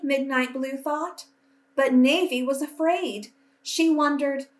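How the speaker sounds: put-on reading a picture book aloud to children, unhurried; storytelling voice. A narrator's reading. Teacher reading to her class, gentle storytelling voice. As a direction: paint each colourful list for young listeners.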